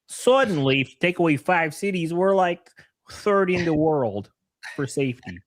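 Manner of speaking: lightly voiced Chicago guy; Chicago accent. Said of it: "I can't do a good Cecil".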